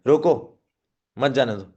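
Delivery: रोको punched, gap, then मत जाने दो falling; forceful रोको, pause. Shown as a demonstration. Trainer imagining someone is leaving, pause after रोको. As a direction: stop sharply after रोको. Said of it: "रोको को फोर्सफुली किया".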